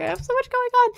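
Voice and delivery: high, overexcited burst; excited. Real, not acted. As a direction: blurt it out overwhelmed. Overwhelmed by her many links.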